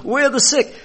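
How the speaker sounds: high, loud chant; hyped cheer. Retold or imitated. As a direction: cheer it to the crowd. Tax collectors celebrating.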